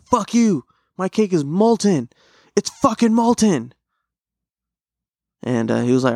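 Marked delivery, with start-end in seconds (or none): 0.0-3.7 high-pitched mock angry outburst; acted-out freak-out. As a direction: flip out like an outraged chef, pitch way up. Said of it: "freaked out, uh, fucking flipped".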